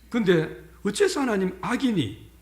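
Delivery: ordinary delivery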